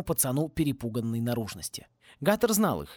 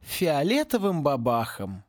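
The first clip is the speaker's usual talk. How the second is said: sad ending; slower than usual. A narrator's reading. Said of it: "грустно завершил за них Луж".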